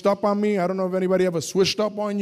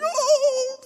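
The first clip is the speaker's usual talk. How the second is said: crying out. Retold or imitated. Overwhelmed at answered prayer.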